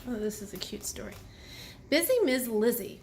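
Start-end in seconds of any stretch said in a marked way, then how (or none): 0.1-1.1 whispered aside. Real, not acted.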